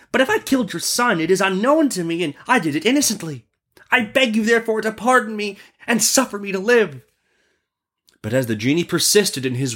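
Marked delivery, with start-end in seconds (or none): 0.1-7.0 high-pitched, anxious merchant plea; pleading merchant. Acted. Merchant pleads innocence to the genie, high pleading. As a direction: beg for your life in a high voice.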